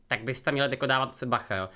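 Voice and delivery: ordinary talk, nothing marked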